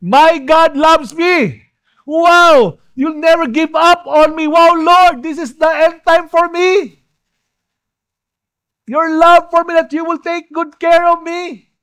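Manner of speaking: high, loud, exultant preaching; impassioned worship exhortation; full-force preaching. Real, not acted.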